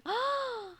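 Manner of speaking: high excited gasp; delighted gasp. Real, not acted. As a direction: gasp with glee. Reacts again.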